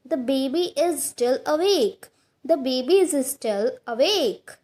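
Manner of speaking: slow, careful teacher read of each word; slow model sentence. Shown as a demonstration. Teacher reading an example to Hindi learners, slow and clear. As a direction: say it slowly so students can repeat. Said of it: "teacher models example slowly".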